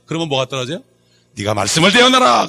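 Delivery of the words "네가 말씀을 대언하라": loud, raised proclamation; commanding divine voice. Acted. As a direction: declare it like God commanding a prophet. Acts out God's answering command. (크게 선포하는 명령조)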